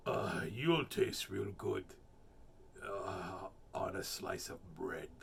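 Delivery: a hungry beast savoring the thought; Gruffalo voice. Acted. Gruffalo telling the mouse he'll taste good, low monster voice.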